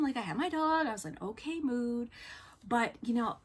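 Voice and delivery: ordinary talk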